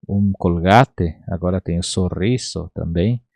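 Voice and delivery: normal talking voice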